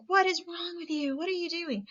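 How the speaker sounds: high-pitched mock yell of a scared, frantic parent; imitating parent yelling. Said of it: "yells at them and is like".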